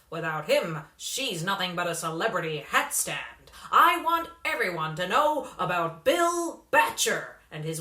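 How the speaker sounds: put-on loud, pushy hustler calling a kid over; a slick manager leaning in with a sales pitch; brash showbiz manager. A narrator's reading. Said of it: "said the man".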